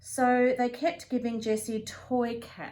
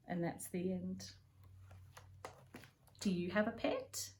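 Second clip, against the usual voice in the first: plain reading voice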